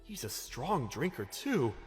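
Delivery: slow, soft, impressed aside; hushed remark. Acted. Coworkers watching a man gulp sake, hushed. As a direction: murmur it among onlookers. Onlookers murmur at party.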